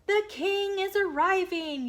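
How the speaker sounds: loud, high herald's cry; yelled. Acted. Circumference warning the hidden guests, a loud high yell. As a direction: call it out to the whole meadow. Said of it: "yelled Circumference".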